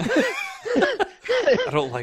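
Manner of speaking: slow, drawn out through laughter; laughing mock dread. Real, not acted. Friend reacts to the scary scene, slow laughing dread. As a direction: drag it out laughing.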